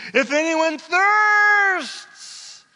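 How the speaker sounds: loud, high, drawn-out cry; shouting. Acted. Preacher shouting Jesus' call to the congregation. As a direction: shout the invitation across the hall. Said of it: "I'm shouting".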